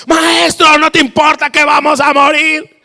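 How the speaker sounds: high, loud desperate cry; acted full-voiced shout of alarm; shouting at Jesus. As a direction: yell to rouse the sleeping teacher. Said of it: "alguien y está gritando".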